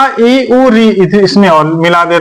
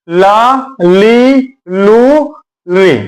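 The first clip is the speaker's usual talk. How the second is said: slow recitation; much slower than usual. Shown as a demonstration. Teacher drilling students on the syllables, very slow recitation. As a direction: spell out each syllable very slowly. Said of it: "तो हमारा ये क्या हो गया".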